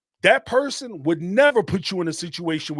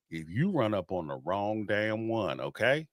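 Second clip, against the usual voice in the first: said real slow; 10 semitones lower, 8 dB quieter than usual. Real, not acted. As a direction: lower and softer, each word laid out. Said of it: "I'm gonna say this real slow".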